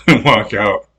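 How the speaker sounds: usual speaking voice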